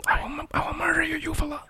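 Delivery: mock-menacing soft whisper; creepy whisper. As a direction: whisper an absurd threat. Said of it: "Why are you talking like that?".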